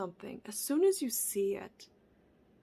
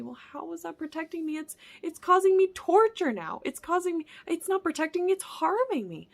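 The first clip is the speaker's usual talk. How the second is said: higher, louder exasperated complaint; voiced protest; 8 semitones higher, 6 dB louder than usual. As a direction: protest as the hurt self. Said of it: "sometimes it can be hard to see".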